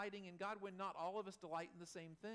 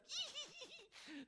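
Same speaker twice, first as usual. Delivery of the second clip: high, tight squeal of glee; acted squeal of uncontainable delight; gleeful squeal. Shown as a demonstration. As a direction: squeal through a huge strained grin. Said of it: "that muscle tension of".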